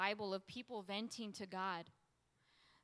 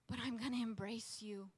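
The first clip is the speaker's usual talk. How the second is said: soft, tender resolve; hushed vow of trust; embracing surrender; 4 dB quieter than usual. Acted. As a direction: drop into quiet devotion. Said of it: "to embracing".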